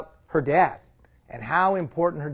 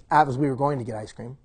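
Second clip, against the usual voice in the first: normal talking voice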